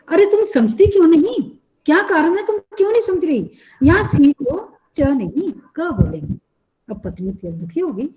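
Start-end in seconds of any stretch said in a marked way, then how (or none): 0.1-6.3 higher, louder exasperated scolding; irritated loud scolding. A narrator's reading. Husband losing patience with his wife, exasperated higher, louder scolding. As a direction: snap at her in exasperation. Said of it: "बड़े तेज स्वर में बोले".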